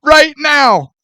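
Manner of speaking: high, drawn-out exasperated shout; exasperated yelling. Real, not acted. Demanding a clip to win the argument, exasperated yelling. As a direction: yell it slowly, insisting on every word. Heated argument over the accent.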